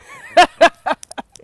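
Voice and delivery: a big loud burst of laughter; hearty laugh. Real, not acted. Laughing at her own line to the listeners.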